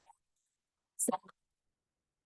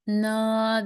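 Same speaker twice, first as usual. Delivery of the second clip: ordinary talk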